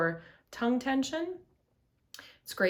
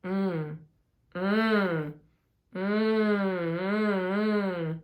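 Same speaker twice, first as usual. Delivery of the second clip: resonant humming; yummy hum; 5 dB louder, much slower than usual. Shown as a demonstration. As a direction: hum like tasting something yummy. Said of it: "Now say a yummy noise".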